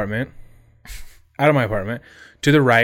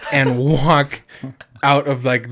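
ordinary talk, nothing marked

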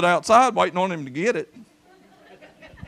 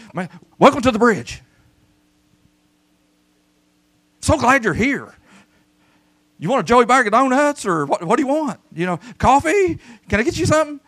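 friendly welcome; 6 semitones higher, 5 dB louder than usual. Pretend. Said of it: "be like".

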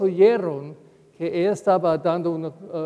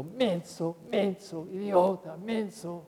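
muttered insults; 7 dB quieter, slower than usual. Retold or imitated.

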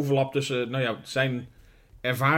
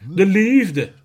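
high, loud hammed-up swoon over love; echoing partner's sigh about love, hammed-up swoon; mock romantic swoon; 6 semitones higher, 9 dB louder than usual.

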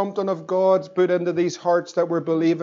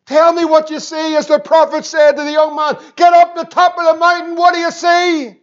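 rising, ever louder and higher preacher's call; preaching at full force; 8 semitones higher, 7 dB louder than usual. Acted. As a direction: build the appeal to a shouted climax. Sermon climax building up.

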